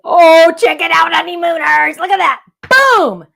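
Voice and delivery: loud, high-pitched triumphant holler; real high, booming excitement; excited yell. Real, not acted. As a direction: yell it out, thrilled it worked. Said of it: "I sometimes yell".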